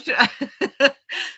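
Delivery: high emotional laugh; teary laugh. Real, not acted. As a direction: laugh out the tears. Remembering the medium's message.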